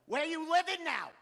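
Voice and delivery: high, loud nosy aunt; Boston aunt. Retold or imitated. Aunt quizzing nephew on where he lives, loud. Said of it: "my aunt was like".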